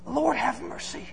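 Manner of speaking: soft, hushed plea for mercy; quiet prayer. Shown as a demonstration. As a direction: pray it gently, almost under the breath. Prayer modelled after Bartimaeus' cry.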